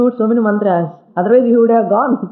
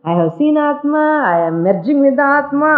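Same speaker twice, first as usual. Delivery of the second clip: raised, boastful claim said half in jest; a hint of a show-off mystic; mock boastful blabbering; 6 semitones higher than usual. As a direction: lightly imitate a self-important seeker bragging. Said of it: "they blabber like anything".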